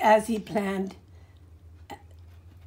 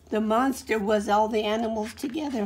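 ordinary delivery, nothing marked

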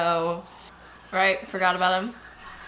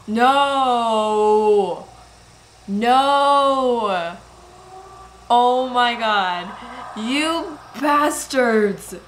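outraged cry; 2 semitones higher, 6 dB louder, much slower than usual. Real, not acted. Upset at the movie scene.

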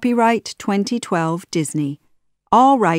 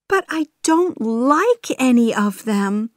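high-voiced upset princess; distressed young princess's objection; crying out in protest; 6 semitones higher than usual. A narrator's reading. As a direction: protest to a father, pitched high. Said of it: "Jasmine cried".

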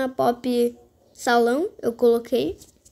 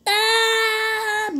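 high sung cry of defeat; sung wail; 8 semitones higher than usual. Real, not acted. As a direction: wail it out in song. Loses the round.

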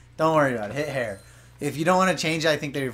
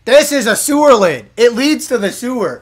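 raised, louder mock announcer voice; character voice; 6 semitones higher, 9 dB louder than usual. Dubbing a game NPC for a friend, high loud put-on voice. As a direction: read the game's line in a higher, loud put-on voice.

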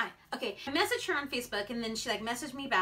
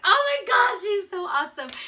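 higher, much louder retold gush; excited squeal; 9 semitones higher, 9 dB louder than usual. Vlogger retelling her thrilled reply to the breeder, excited. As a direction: gush with delight.

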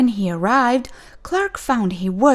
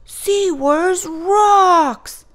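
high, very slow, louder cheer; excited cry; 12 semitones higher, 6 dB louder, much slower than usual. Acted. Clark playing with the toy, high gleeful cheer. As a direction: belt it out stretched with glee. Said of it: "cried Clark".

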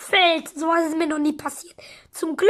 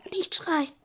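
whisper; 3 semitones lower, 9 dB quieter than usual. Acted. Whisper again.

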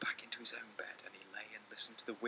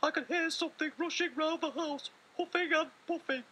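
calling little mouse; 8 dB louder than usual. Acted. Little Mouse calling his brother at night, high squeaky call. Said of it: "called Little Mouse".

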